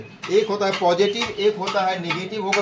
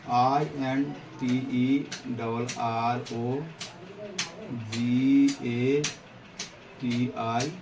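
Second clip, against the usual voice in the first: very slow, low letter by letter; spelling out; 6 semitones lower, 5 dB quieter, much slower than usual. Shown as a demonstration. Teacher spelling a word for the class, slow and measured. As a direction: spell it out slowly for note-taking.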